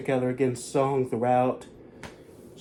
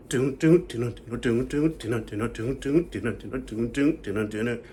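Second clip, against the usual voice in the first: vocalized beat. Shown as a demonstration. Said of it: "do the breakdown".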